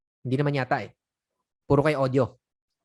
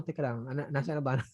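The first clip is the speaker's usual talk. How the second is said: much softer, searching the screen; muttering to self; 3 semitones lower, 9 dB quieter than usual. Shown as a demonstration. Agent muttering while clicking through the script, very soft. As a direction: mutter while hunting for the page. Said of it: "o kiniklik mo".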